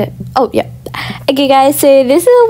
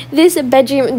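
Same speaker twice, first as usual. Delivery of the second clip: usual speaking voice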